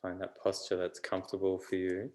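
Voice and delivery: unhurried meditation voice with long pauses; calm, even delivery, phrase by phrase; guided meditation. Real, not acted. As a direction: gently guide them inward, leaving space between phrases.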